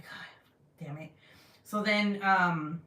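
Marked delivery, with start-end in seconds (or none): 0.0-1.1 whispered, slow exasperated curse; muttered curse. Retold or imitated. Relieved mother in the taxi cursing under her breath, whispered.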